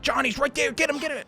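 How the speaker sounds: high-pitched, eager egging on; squeaky-high mock excitement; mock excited urging. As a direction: imitate someone urging an attack. Imagined eager player's cry.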